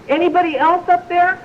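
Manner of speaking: high-pitched hopeful call upward; mock plea for someone else; hanging man calling up. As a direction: deliver the punchline as a high call to the sky. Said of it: "and he says".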